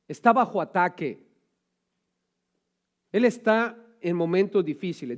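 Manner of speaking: preaching at full force. Acted. Preacher stirring the congregation, loud fervent preaching. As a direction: preach it out to the church.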